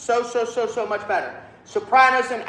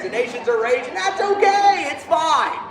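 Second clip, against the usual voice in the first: high, loud, breezy parody of not caring; mock unconcern; 8 semitones higher, 4 dB louder than usual. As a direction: sail up high in exaggerated cheer. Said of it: "and who cares?".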